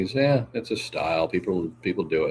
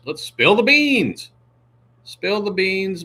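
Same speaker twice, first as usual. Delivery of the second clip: teasing sing-song; 11 semitones higher, 5 dB louder, slower than usual. Acted. Teasing Margie to confess.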